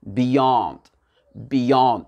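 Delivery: "beyond" with brief "bi" and long open "YAHND"; stress on second syllable. Shown as a demonstration. Teacher repeating the model for learners, second-syllable stress. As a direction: stretch and stress the end of "beyond". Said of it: "il est sur le Y ou N D".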